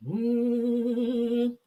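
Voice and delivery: long steady "mm" hum; sustained meditative hum; calming hum. Real, not acted. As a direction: hold one even hum to settle yourself. Humming to calm down.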